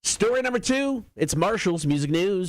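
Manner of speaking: high, stretched-out radio intro; segment announcer. Acted. Host introducing story number two of music news, announcer style. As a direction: announce the next story with a lifted voice.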